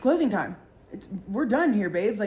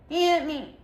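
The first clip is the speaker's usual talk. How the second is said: higher mock drunk whine; 7 semitones higher than usual. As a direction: whine it from the floor. Drunk friend on the ground.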